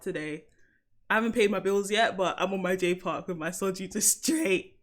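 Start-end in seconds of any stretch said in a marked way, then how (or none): 4.0-4.8 voice crack. Real, not acted. Speaker joking about straight soju, voice cracks. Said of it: "Oh, voice crack".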